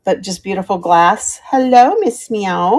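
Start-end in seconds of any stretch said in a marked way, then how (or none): none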